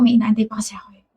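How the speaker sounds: breathy whisper, voice unvoiced. To the waiter, a hushed whisper. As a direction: murmur it under your breath.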